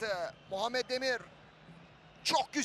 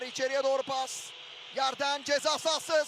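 ordinary talk, nothing marked

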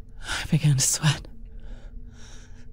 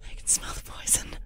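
despairing whisper; 12 dB quieter than usual. Acted. Poisoned woman realizes her mistake, whispering. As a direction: let it slip out, barely voiced.

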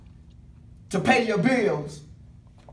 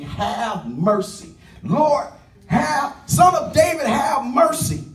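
pleading cry; 4 semitones higher, slower than usual. Retold or imitated. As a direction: build from a plea to a cry. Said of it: "you are saying".

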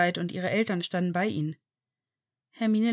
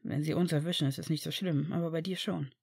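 hushed warning; 3 semitones lower, 6 dB quieter than usual. A narrator's reading.

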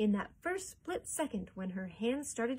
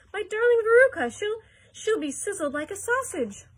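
very loud, high, frantic wail; yelling; 12 semitones higher, 12 dB louder than usual. A narrator's reading. Mother fearing her daughter will be sizzled, hysterical yell. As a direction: wail at full volume, stumbling in horror. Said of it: "yelled Missus Salt".

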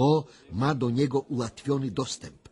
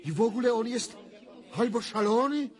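higher, rising alarm as the vision unfolds; higher, tense visionary urgency; agitated trance vision; 10 semitones higher than usual. Acted. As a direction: speak as if seeing it, growing alarmed.

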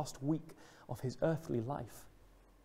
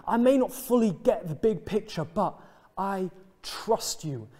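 lightly voiced disciples, louder and insistent; trusting disciples; 3 semitones higher, 6 dB louder than usual. Disciples praising Jesus despite not knowing what's coming, trusting declaration. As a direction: declare faith with raised volume. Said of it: "That is the faith that these disciples are displaying".

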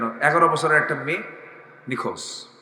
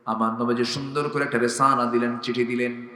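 normal talking voice

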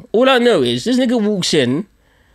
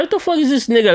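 annoyed surprise; 8 semitones higher, faster than usual. Retold or imitated. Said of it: "I'm just like".

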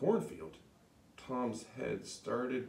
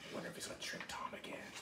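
whisper; 12 dB quieter, much faster than usual. Real, not acted. Whispered aside to children.

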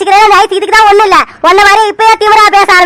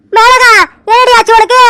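usual speaking voice

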